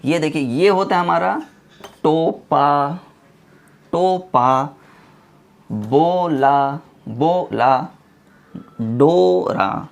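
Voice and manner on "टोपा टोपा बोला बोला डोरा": long held o in टोपा, बोला, डोरा; stretched first syllable. Shown as a demonstration. Teacher reading practice words to students, first vowel stretched. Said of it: "थोड़ा सा यहाँ पे थोड़ा सा खींच देंगे".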